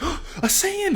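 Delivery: very high panicked gasp; hammed-up high-pitched alarm; freaking out. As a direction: imitate a child's startled panic. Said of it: "he starts freaking out".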